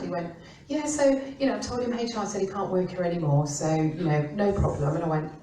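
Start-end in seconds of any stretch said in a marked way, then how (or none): 0.7-5.0 tossing it off like it's nothing, voice raised; careless boss. Retold or imitated. Client retold, careless and loud.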